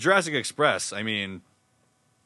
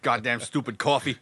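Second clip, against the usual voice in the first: put-on cranky New York accent, building louder and higher; 5 semitones higher, 3 dB louder than usual. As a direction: start grumbling, end in a loud New York gripe. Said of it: "just doing the New York accent".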